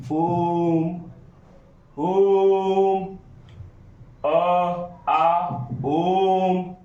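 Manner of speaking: ओम held very long, closing on म; prolonged Om. Shown as a demonstration. Teacher to students, demonstrating the three-beat ओम. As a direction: draw the breath out on ओम. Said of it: "last में म पर जाकर ये stop करता है".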